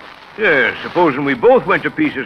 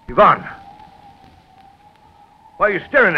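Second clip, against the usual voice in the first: alarmed call; 9 semitones higher, 5 dB louder, faster than usual. Acted. Father alarmed by son's stare.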